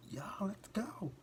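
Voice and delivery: high, drawn-out breathy mock cheer; mock rallying call. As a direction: imitate a crowd's rallying cry, stretched out high. Imitates protesters rallying the crowd.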